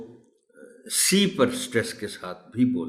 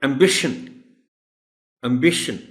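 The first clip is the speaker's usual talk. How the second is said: Ambition stressed on the "bi"; 4 semitones higher, 3 dB louder, much faster than usual. Shown as a demonstration. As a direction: model ambition as "am-BI-shun". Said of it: "बी पर stress है". (Ambition میں bi پر زور)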